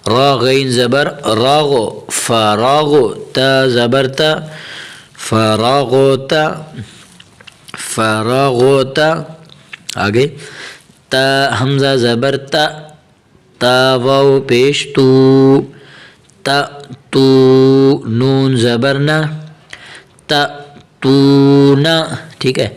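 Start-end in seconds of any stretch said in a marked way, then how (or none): 0.1-9.1 measured letter-by-letter chant; teacher's drawn-out recitation; Quranic spelling recitation. Shown as a demonstration. Spells out Quranic words for learners. 11.1-22.0 measured letter-by-letter chant; teacher's drawn-out recitation; Quranic spelling recitation. Shown as a demonstration. Spells out Quranic words for learners.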